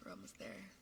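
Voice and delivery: usual speaking voice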